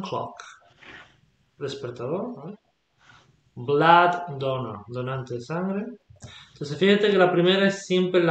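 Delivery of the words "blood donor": Blood stressed, donor lighter, said slowly; stress on first word. Shown as a demonstration. Teacher shows first-word stress to student. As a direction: hit Blood harder than donor. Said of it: "el acento lo lleva en la primera".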